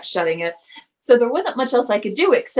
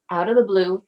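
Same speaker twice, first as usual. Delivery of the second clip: ordinary delivery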